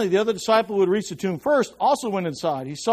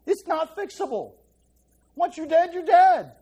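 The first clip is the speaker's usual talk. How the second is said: normal talking voice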